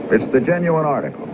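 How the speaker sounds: normal talking voice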